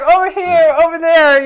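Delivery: high, loud call to the crowd; excited mimicked shout from the stage; imitated stage call. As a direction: call out like a bandleader working a crowd. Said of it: "Okay, you're like".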